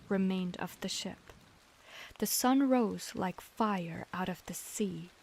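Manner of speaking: ordinary delivery, nothing marked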